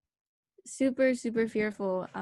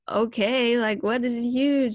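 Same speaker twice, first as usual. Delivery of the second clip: loud, excited rush of nerves; half-panicked excitement over a big email; 3 semitones higher, 7 dB louder, slower than usual. Retold or imitated. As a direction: blurt it out, buzzing with nerves. Excited reaction to USC email.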